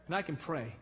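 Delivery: ordinary delivery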